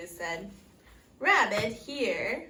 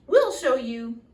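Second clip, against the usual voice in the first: much louder shout; loud call; 11 dB louder than usual. A narrator's reading. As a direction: call out eagerly. Goats calling out.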